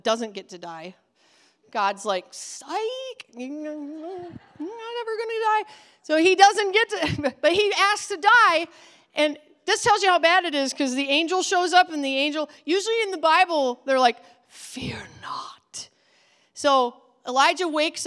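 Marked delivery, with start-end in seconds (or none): 2.3-5.6 quiet, high teasing voice; playful tease. Acted. God teasing Elijah. 14.5-15.9 soft, low whisper; whispered angel. Acted. Angel speaking.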